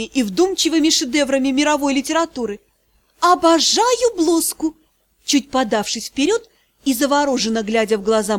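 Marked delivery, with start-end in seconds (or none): 3.2-4.8 медленно, с притворным восторгом; восхититься нарочито, растягивая; наигранный восторг. Acted. (наигранно восторженно)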